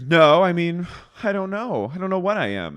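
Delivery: high, loud, confused mock voice; flustered Miranda. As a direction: stammer it out lost. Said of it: "and Miranda goes".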